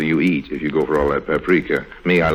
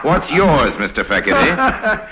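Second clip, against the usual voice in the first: laughing through the question, a bit louder; 9 semitones higher, 4 dB louder, slower than usual. Acted. Harry turns the question back on the banker, laughing.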